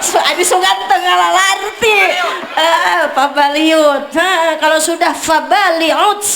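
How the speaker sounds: slow, half-sung mock Arabic. Preacher teases the crowd with fake Arabic, slow half-sung. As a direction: chant it like pretend Arabic.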